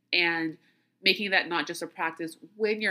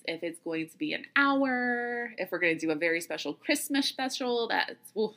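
ordinary talk, nothing marked

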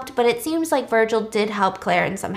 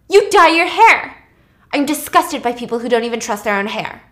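rude blurt; 7 dB louder than usual. Retold or imitated. Claire rudely criticising Virgil's dyed hair, blurted out. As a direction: blurt it rudely, first words loud. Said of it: "she blurts out, kinda rudely".

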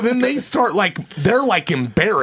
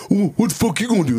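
scared and angry; 4 semitones higher, faster than usual. Retold or imitated. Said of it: "the guy was like".

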